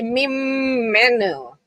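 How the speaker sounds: Mim menu with a long, held m; imitated drawn-out consonant. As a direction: stretch the m in "Mim menu" slowly, like the reader. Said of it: "he always say".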